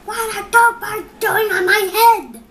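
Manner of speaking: high, loud indignant question; alarmed outcry. Acted. Elephant loudly demanding why two birds are on his head.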